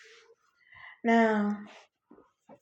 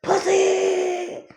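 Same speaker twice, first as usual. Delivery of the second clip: high-pitched drawn-out holler; retold scream, pitched way up; 12 semitones higher than usual. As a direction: scream it out high, stretching the last vowel. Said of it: "And I screamed out".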